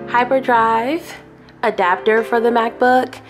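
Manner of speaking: normal talking voice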